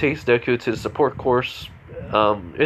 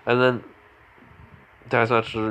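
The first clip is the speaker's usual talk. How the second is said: usual speaking voice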